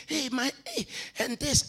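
whiny excuse. Retold or imitated. Mocking a tired excuse-giver.

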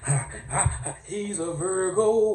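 high, loud hammed-up sermon with gasped breaks; preacher voice. Comic preacher calling up Virgo witnesses, high whooping sermon. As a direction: whoop out each name like a preacher in full flow. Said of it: "let me get my preacher voice".

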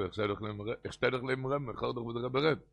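normal talking voice

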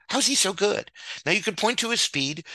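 ordinary talk, nothing marked

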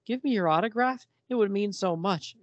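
high-pitched starstruck fan woman; hammed-up adoring admirer; gushing fan. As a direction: beg for the autograph breathlessly. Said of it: "she says".

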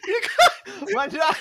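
high giggling showman call; laughing announcement. Pretend. Mock stage announcement.

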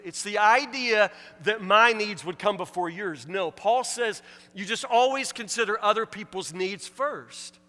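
loud, high, emphatic sermon delivery; forceful preaching. Real, not acted. Pastor urging the church to put others first, forceful preaching. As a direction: drive the point home loudly.

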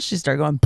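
trumpeting elephant noise; elephant sound. Retold or imitated. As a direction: mimic a baby elephant trumpet. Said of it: "making the little fake elephant sound".

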